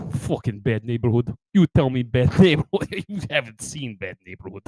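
put-on thick Russian accent. Comedian protecting himself in bad neighborhoods, Russian accent. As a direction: menace them in a Russian accent. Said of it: "he keeps a Russian accent".

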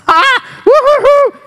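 high whooping yell; a high joyful whoop. Retold or imitated. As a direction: whoop out loud in the bathroom. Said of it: "and just".

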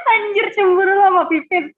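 teasing laugh. Real, not acted. Teasing cohost.

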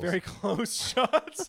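slow words through laughter; laughing. Real, not acted. Laughing at photos.

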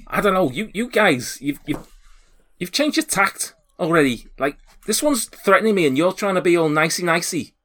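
high, flustered complaint; shouting door guard. Acted. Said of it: "a shouting voice".

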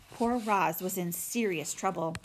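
usual speaking voice

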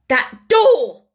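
loud, high exclamation; indignant cry. A narrator's reading. Headmistress cries out at the sight of the doll, indignantly. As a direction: cry out accusingly. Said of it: "cried Miss Minchin".

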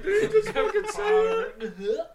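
very high, slow, loud squeal of laughter; laugh-crying at what he just heard; laughing disbelief. Retold or imitated. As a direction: squeak it out through tears of laughter. Teller's own reaction quoted, laughing.

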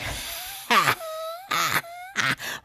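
high mocking witch cackle; imitated witch laugh. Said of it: "like a witch in the back".

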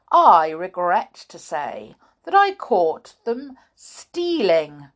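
loud, slow, menacing teacher with a growl; frightening schoolmaster. A narrator's reading. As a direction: threaten the class, drawing out the words. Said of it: "This was very frightening".

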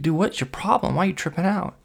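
high-pitched incredulous challenge; exasperated questioning. Retold or imitated. Asking his panicking friend what's wrong, exasperated.

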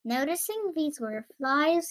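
plain reading voice

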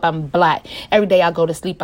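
ordinary talk

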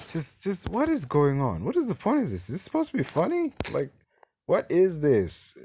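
high-pitched, baffled ranting; squeaky-high bewilderment at bad writing; exasperated disbelief. Real, not acted. Exasperated rant at a baffling scene.

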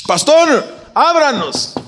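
llamado agudo y fingido al pastor; llama como pidiendo que abran; llamando a la puerta. Acted. (llamando en voz aguda)

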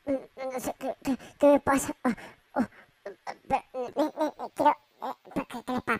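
confused stammer as the voice starts shifting up; voice starting to change. Acted. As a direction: stutter as the voice goes strange. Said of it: "qué le pasa a mi voz".

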